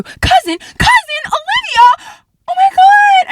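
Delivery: building to a high, loud squeal; reenacted explosive surprise; imitated dawning excitement. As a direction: escalate from noticing to thrilled shriek. Aunt's realization building to a scream.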